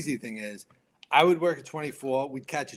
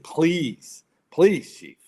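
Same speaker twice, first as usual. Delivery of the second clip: retold plea, higher and louder; pleading; 5 semitones higher, 8 dB louder, much slower than usual. Firefighter begging his chief for permission, urgent retold plea. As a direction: beg the boss, punching each please.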